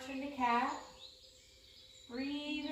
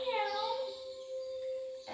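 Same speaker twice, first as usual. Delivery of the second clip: high, playful kitten meow; cat meow; 13 semitones higher, 3 dB quieter than usual. Pretend. Yoga teacher meowing for kids in cat pose, high playful meow. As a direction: squeak it out like a kitty. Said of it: "you may be a cat".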